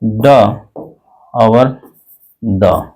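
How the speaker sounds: D sounds ड and द; two d sounds, ड then द; letter sounds d. Shown as a demonstration. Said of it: "डी को आपको बोलना है".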